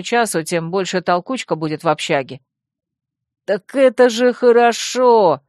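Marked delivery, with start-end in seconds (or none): 3.4-5.4 higher, louder, drawn-out cheer; bouncy delight. A narrator's reading. Vemut cheering to the women, bouncy and delighted. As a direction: exclaim it slowly with gleeful lift. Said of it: "подпрыгнул Вемут".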